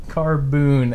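Carboon with a long oo in the second syllable; imitating a friend's Carboon; Carboon mispronounced. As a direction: say Carboon, stretching the oo. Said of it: "he says Carboon".

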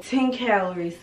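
usual speaking voice

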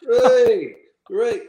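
high, loud cheer, then easing off; drawn-out mocking cheers; retold group cheer. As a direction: whoop like the lads in the changing room. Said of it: "we all cheered".

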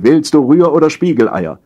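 high, loud domestic interruption; interrupting wife. A narrator's reading. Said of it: "unterbrach Sarahs Stimme".

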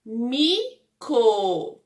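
"Mico" as two separate syllables, mi, co; split into syllables. Shown as a demonstration. Teacher sounding out the word for pupils, "Mico" syllable by syllable. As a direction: leave a clear gap inside "Mico". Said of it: "E aí, formou mico".

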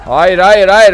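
loud, high panicked no no no; panicked shout. Real, not acted. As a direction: shout it in panic as the alarm goes off. Panic as alarms sound. (panikle bağırarak)

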